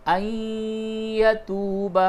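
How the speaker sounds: ان یتوب with the n merged nasally into the ya; idgham with ghunna. Shown as a demonstration. Teacher repeating the idgham of ان یتوب, nasal merge. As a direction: blend ان into یتوب with a nasal hum. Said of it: "یہ ادغام کرنے کا طریقہ دیکھیے".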